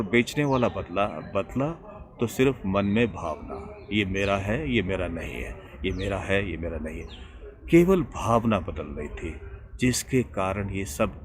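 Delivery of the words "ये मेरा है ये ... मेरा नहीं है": quiet refrain. Shown as a demonstration. Reflective repetition of feeling.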